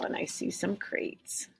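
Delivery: whispered aside to listener. Real, not acted. Reader pointing at the picture, whispered. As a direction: breathe it softly as a secret. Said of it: "reader whispering aside".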